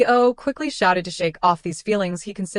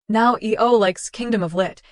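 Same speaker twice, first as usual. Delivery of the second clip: usual speaking voice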